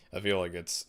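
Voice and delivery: ordinary talk, nothing marked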